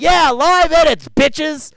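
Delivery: high, loud whoop; mock cheer. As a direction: celebrate like at a party. Cheering about live edits.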